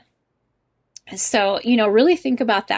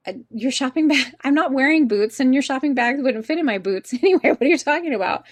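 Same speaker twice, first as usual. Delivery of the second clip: mock bafflement, higher pitch with laughs breaking in; baffled; 4 semitones higher, faster than usual. Teacher recalling confusion over English friend's "boot", mock baffled. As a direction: act out amused confusion, pitched up. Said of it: "And I thought".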